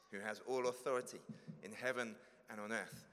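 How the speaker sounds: normal talking voice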